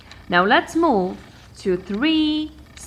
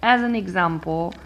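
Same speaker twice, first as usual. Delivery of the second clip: normal talking voice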